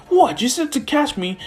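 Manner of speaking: high-pitched, baffled protest; indignant surprise. Retold or imitated. Izuku protesting Gran Torino's scolding, indignant. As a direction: jump up high in disbelief, defending yourself.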